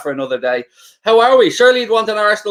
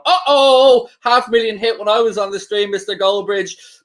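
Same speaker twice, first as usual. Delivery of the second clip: teasing wind-up; 4 semitones higher than usual. Retold or imitated. Streamer texting his colleague to wind him up, teasing. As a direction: gleefully rub it in, pitched up. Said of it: "so I could wind him up".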